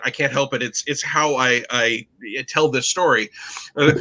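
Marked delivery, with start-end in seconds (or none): none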